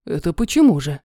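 low, curious man's voice; interested major. A narrator's reading. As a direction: perk up, low and intrigued. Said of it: "заинтересовался информацией майор". (заинтересованный низкий голос)

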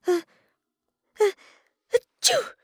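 building uh uh achoo; big sneeze. Acted. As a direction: sneeze it out loudly. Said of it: "Alice sneezed".

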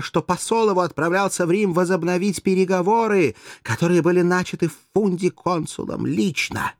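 high, forceful argument; impassioned, emphatic assertion; heated insistence. A narrator's reading. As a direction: press your certainty on him. Courtesan finishes her heated argument.

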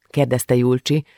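ordinary delivery

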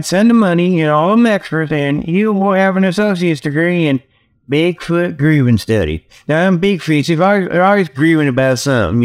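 normal talking voice

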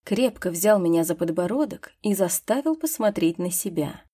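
plain reading voice

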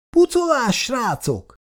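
hoarse shout. Acted. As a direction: yell at the boys to run for it. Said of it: "kiáltotta rekedten az ilyet Maki".

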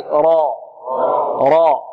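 راء with the mid-tongue hollowed; emphatic راء, tongue cupped; heavy ra. Shown as a demonstration. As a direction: model a thick, dark راء for repeating. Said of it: "يتقعر هنا وسط اللسان".

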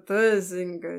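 slurred tipsy voice; drunk. Acted. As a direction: slur the name like a drunk. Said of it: "заплетающимся языком".

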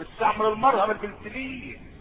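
usual speaking voice

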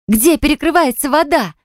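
high startled shriek of a question. Acted. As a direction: shriek in panic as icy water sprays. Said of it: "завизжала Анфиса". (визг в панике)

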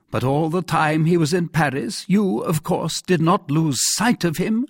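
high-pitched royal questioning; anxious king. Acted. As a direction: press the minister for confirmation.

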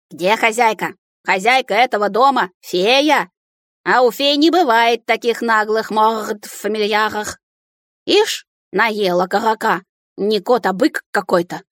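ворчливая тирада картавого домового; возмущайся, раскатисто картавя; картавое возмущение. A narrator's reading. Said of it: "возмущался всё тот же картавый голос". (возмущаться картавым голосом)

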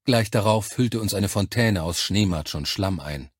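ordinary delivery, nothing marked

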